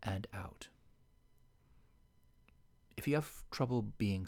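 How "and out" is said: soft spa whisper. Shown as a demonstration.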